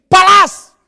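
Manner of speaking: high, loud shouted command; mock wife's shout. Wife urging her husband to hit back, shrill loud shout. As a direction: bark it like an angry wife.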